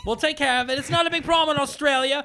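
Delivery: high-pitched cartoon Aussie woman; hammed-up Aussie cop reassurance; Australian lady cop. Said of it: "It's an Australian lady cop".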